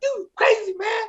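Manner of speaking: high-pitched imitated fans; admiring excitement. Passengers suddenly loving the booed comic, high excited praise. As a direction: gush in a high mock voice. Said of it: "they love me".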